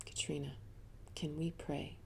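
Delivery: softly whispered question; quiet, gentle retold minister; hushed minister. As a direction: lean in and whisper the invitation to pray. Minister asks gently at bedside.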